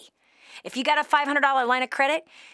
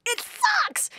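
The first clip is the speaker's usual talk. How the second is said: sudden high squeaky mock whine; pitched way up in mock complaint. Shown as a demonstration. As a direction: echo it like a whiny kid.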